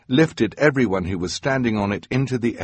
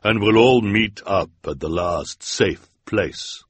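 commanding villainess. Acted. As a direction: give the order coldly. Woman with hair orders Olaf.

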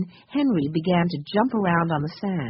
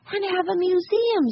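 put-on very high little-kid voice; Benny's child voice; 12 semitones higher than usual. A narrator's reading. Benny adding to the museum plan, high child voice. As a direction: chime in high as a small child.

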